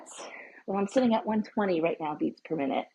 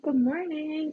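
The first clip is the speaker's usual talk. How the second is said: cheerful high greeting; 7 semitones higher, 3 dB quieter, slower than usual. Real, not acted. Greeting new viewers.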